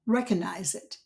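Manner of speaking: normal talking voice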